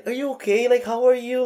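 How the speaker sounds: high-pitched, gushing concern; eager, high retold questions; overly interested. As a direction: imitate someone fussing over a newcomer. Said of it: "sobrang interested niya sa akin".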